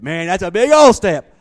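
big emphatic outcry. Retold or imitated. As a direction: belt it out in disbelief. Friend protests the step is big.

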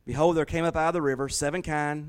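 scripture reading. Acted. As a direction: read the verse out like a preacher. Reads Genesis aloud.

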